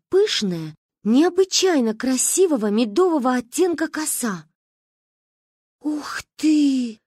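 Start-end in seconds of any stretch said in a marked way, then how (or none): 5.8-7.0 high awed "Ух ты"; breathed wow. Acted. Girls gasp at the girl's long braid. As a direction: exhale in wonder. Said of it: "выдохнули мы".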